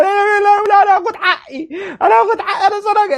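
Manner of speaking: high, squeaky put-on voice; mock petulant player. Imitating Shahat demanding payback, high and squeaky. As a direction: play a hothead who sounds like a squeaky kid. Acts out Shahat wanting revenge.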